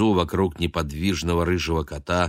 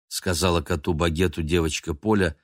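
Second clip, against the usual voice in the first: plain reading voice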